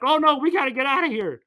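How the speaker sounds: high, loud mock panic; dad urging an escape, high and loud. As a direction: yelp it like a frightened dad. Panicked father voiced in retelling.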